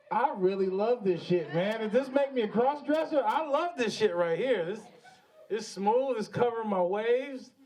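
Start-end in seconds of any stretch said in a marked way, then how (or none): none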